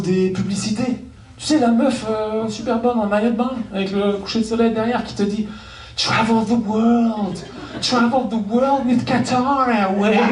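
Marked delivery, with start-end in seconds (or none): 6.0-10.2 slow seductive airline ad voice; breathy commercial model voice, drawn out; sultry ad voice. Pretend. Airline ad voice parody.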